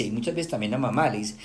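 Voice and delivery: ordinary talk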